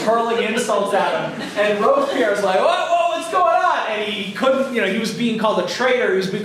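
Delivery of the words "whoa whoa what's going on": high-pitched startled protest; mock alarm. Robespierre facing the shouting convention, hammed-up alarm. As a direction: yelp it in bewildered panic, imitating. Acting Robespierre under a barrage of insults.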